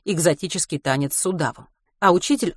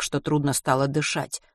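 usual speaking voice

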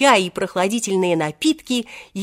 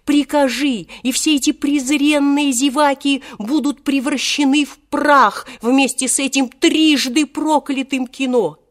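put-on high, agitated old genie threatening destruction; high-pitched theatrical outrage; nervous, wrathful genie; 6 semitones higher, 4 dB louder than usual. A narrator's reading. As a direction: declaim the threat with rising fervour. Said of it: "нервно сказал он".

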